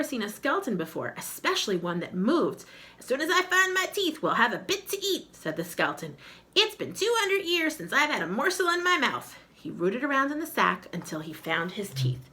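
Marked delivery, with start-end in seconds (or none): 3.0-5.4 high, louder hungry skeleton; a greedy skeleton promising dinner. Acted. Skeleton speaks. 6.5-9.4 high, louder hungry skeleton; a greedy skeleton promising dinner. Acted. Skeleton speaks.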